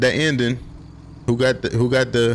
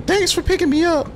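high friend voice. Acted. Voicing friend character.

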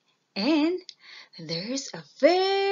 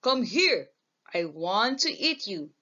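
troll voice; 11 dB louder than usual. A narrator's reading. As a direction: boom it like a grumpy troll. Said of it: "says the troll".

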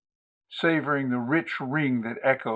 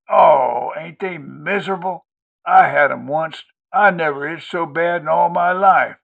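high, emphatic country sympathy, loud in bursts; commiserating country woman; 4 semitones higher, 6 dB louder than usual. A narrator's reading. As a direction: complain along in a folksy, raised voice. Maudie commiserates over hives.

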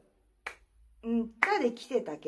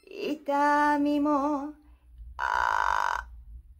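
creaky edge on the attack; vocal fry; 3 semitones higher, much slower than usual. Shown as a demonstration. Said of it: "最初はエッジを入れて".

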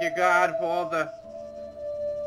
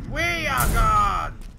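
proclaiming; 8 semitones higher, much slower than usual. Acted. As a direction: declare it grandly.

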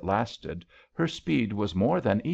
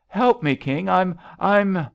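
high, loud, stammering fear; panicked plea; 10 semitones higher, 7 dB louder than usual. A narrator's reading. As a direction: beg for rescue while going under. Drowning woman begs for help.

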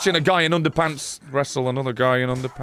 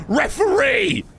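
shouting for the referee; slower than usual. Real, not acted. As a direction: yell up at the referee. Repeats call much higher.

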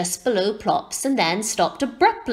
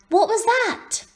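high-pitched eager question; young owlet asking, voice lifted high; curious owlet; 12 semitones higher than usual. A narrator's reading. Plop asks his father about new food, curious and high.

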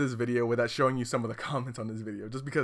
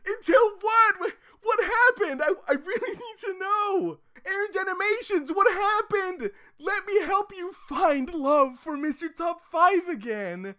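high, shrieking mock sob; mock desperate wail. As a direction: beg like it's a tragedy. Dramatic plea to commenter.

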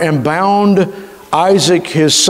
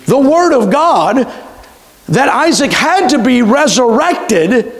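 raised, insistent preacher's voice, higher; fervent preaching; 8 semitones higher than usual. Acted. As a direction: press the point home like a preacher.

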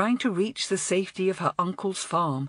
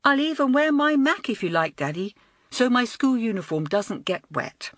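higher, coaxing schoolgirl voice; a lightly voiced girl sweetly bargaining with Daddy; light pleading girl; girl coaxing her father; 5 semitones higher than usual. A narrator's reading.

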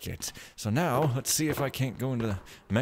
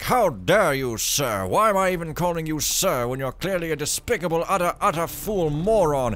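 loud indignant huffing, higher; mock outrage; 6 semitones higher, 8 dB louder than usual. Imagined mechanist taking offense, hammed-up outraged shout. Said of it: "And Mechs be like".

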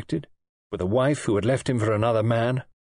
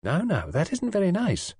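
high, chiding nurse voice; reproving; 9 semitones higher than usual. A narrator's reading. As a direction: gently scold the patient in a higher voice. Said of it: "she said reprovingly".

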